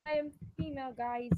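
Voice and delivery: jumps way up high in pitch. Pretend. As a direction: squeeze the voice up high. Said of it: "A horrible voice".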